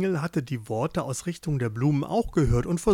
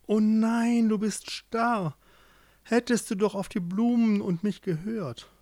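dismayed; 6 semitones higher than usual. A narrator's reading. Joringel finds his beloved frozen stiff, dismayed. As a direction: lament it in mild horror.

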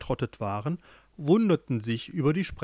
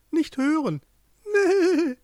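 very high sheep voice, loud bleat at the end; echoing sheep; 13 semitones higher, 4 dB louder, slower than usual. A narrator's reading. Sheep echoes the ram, very high bleating voice. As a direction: parrot it in a squeaky bleat. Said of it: "das Schaf echote".

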